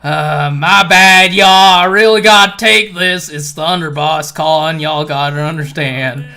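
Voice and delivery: high, loud hollering Texan cowboy; booming boisterous. Acted. Swordsman taking a phone call and leaving, booming Texan holler. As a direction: boom it across the whole hall. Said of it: "his booming voice ... loudly and boisterously".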